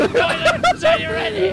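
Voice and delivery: laut rufend. Real, not acted. Ruft Team zusammen.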